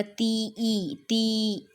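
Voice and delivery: slow demonstration of ती, holding the long "ee" of ई; ती का खिंचा उच्चारण. Shown as a demonstration. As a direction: draw out ती so the ending ई is clearly heard. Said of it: "कैसे pronounce कर रहे हैं". (ती को खींचकर, अंत का ई साफ़ सुनाते हुए)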